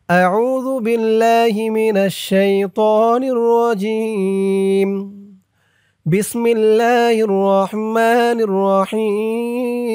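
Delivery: slow, melodic tilawat chant; Quran recitation. Acted. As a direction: draw each syllable out in recitation tone. Recites taawwuz and basmala melodically. (ধীর সুরেলা তিলাওয়াত)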